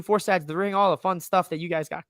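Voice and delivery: normal talking voice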